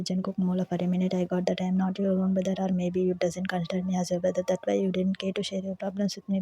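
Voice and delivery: plain reading voice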